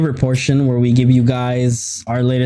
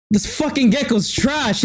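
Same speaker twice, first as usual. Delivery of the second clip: mock gamer trash talk; 10 semitones higher than usual. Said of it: "I was like".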